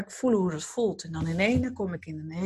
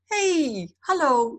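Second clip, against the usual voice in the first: answering voices; 7 semitones higher, much slower than usual. Retold or imitated. Voices answering her call.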